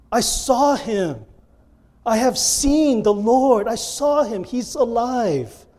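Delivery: slow, high-pitched proclamation; announcing. Shown as a demonstration. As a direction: announce it emphatically, drawing out each phrase. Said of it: "That's announcing. That's not reading".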